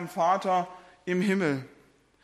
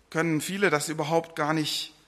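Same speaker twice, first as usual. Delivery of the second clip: ordinary delivery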